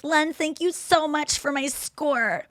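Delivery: high, slow fawning thanks; mock gratitude. Begging to come back on the show, fake gushing thanks. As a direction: gush with fake sweetness, drawn out.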